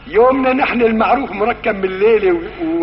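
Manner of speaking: ordinary talk, nothing marked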